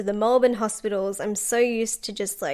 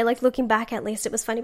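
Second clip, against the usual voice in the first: usual speaking voice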